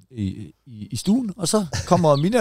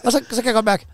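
animated comic rant; 10 semitones higher than usual. Real, not acted. Comic rant about peeing.

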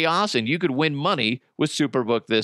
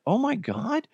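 shocked; 10 semitones higher, 3 dB quieter than usual. Retold or imitated. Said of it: "I'm like".

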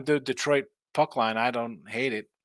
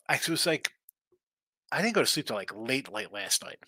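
ordinary talk

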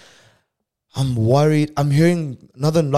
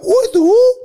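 high, loud disbelieving shriek; sudden high startled cry; shocked; 9 dB louder than usual. Retold or imitated. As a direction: squeal it in total shock. Shocked reaction.

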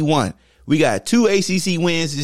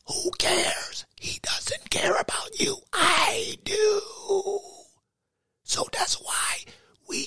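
menacing villain whisper, pitched high; evil whisper; 13 semitones higher, 10 dB quieter than usual. Acted. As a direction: whisper the threats like a cartoon villain. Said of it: "Why are you whispering like that?".

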